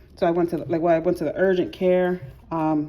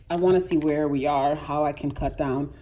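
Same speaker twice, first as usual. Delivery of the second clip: ordinary talk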